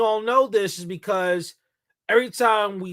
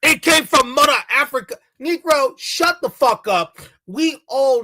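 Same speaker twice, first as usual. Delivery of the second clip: louder and higher, yelling at the screen; angry shouting rant; 6 semitones higher, 6 dB louder than usual. Real, not acted. Vlogger yelling back at the Dominican clip, angry. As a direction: shout him down in real anger.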